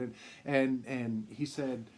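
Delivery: ordinary talk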